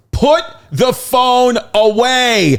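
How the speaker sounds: put-on high, slow, stern little girl; a child copying a strict command, drawn out high; high slow mock-stern kid voice; daughter's stern order. Daughter mimicking Benson at concert.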